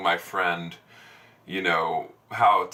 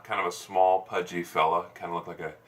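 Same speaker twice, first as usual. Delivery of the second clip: usual speaking voice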